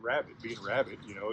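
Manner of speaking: ordinary delivery, nothing marked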